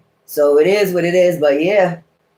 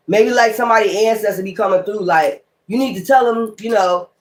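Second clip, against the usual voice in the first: ordinary delivery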